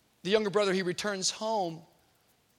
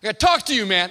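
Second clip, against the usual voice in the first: put-on tough kid confronting; 2 semitones higher, 9 dB louder, faster than usual. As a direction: get in his face with it. Nelson pushes.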